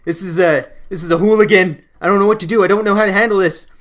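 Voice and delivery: hammed-up terror at the hooligan; mock panic. Scared teen panicking inside about the hooligan, loud mock panic. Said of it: "I'm, like, pooping myself".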